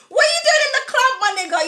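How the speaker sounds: high, loud mock outrage; incredulous shout. Real, not acted. Calling out a spiritual man caught clubbing, incredulous high shout. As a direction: call him out in disbelief.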